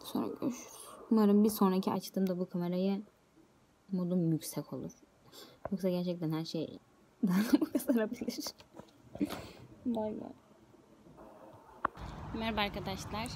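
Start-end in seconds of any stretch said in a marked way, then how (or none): 0.0-0.8 soft unvoiced goodbye; whispered goodbye. Real, not acted. Signing off to her viewers, a whispered goodbye. 5.7-10.3 whispered with a laugh. Real, not acted. Warning viewers about her mood, a laughing whisper.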